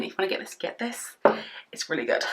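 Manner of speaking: whispered aside to camera while spraying primer. Real, not acted. Beauty vlogger whispering a product tip to viewers. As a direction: drop to an unvoiced whisper.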